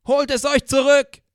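defiant rallying scream; loud defiant cry. Acted. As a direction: hurl it like a rebel yell off a cliff. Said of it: "Es war ein lauter, trotziger Schrei".

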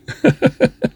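a short high giggle breaking out; amused giggle at own joke. Real, not acted. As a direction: giggle at yourself. Laughs at own indecision.

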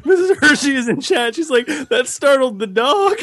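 laughing through the words; giggling while reading out. Real, not acted.

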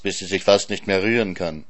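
usual speaking voice

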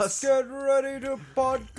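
long rising hype call, stretched out; slow, high ring-announcer build-up; mock arena announcer. Hyping the room up to podcast, announcer call.

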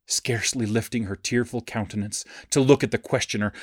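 ordinary delivery

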